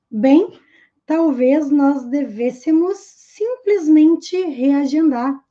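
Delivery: ordinary talk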